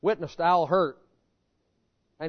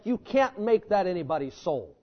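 ordinary talk, nothing marked

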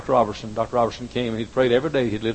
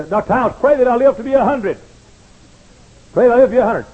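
pleading; 12 semitones higher, 9 dB louder than usual. Retold or imitated. Old man pleading for prayer.

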